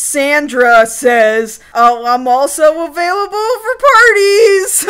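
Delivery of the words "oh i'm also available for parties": high mock-perky voice climbing through the line; hammed-up dub character. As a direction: overplay it as a shameless offer, pitched way up. Mocking a dubbed character's awkward line.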